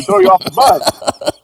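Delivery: usual speaking voice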